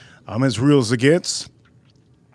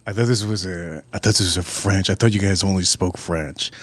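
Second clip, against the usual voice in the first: low put-on customer voice; confused customer; 7 semitones lower than usual. Customer explaining his mistake to waiter, low confused voice. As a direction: drop the pitch and play the puzzled diner.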